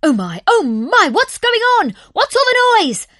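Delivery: very high, loud startled cry; the hare woken by the noise. A narrator's reading. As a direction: wake with a jolt and panic.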